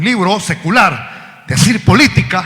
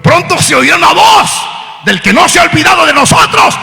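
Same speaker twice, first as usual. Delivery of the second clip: loud, high preacher's shout; preaching at full force; 10 semitones higher, 7 dB louder than usual. Acted. Preacher warning the church, full-force preaching. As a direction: build to a cry. Sermon climax.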